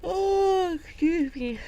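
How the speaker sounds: surprised. Real, not acted. Startled interruption.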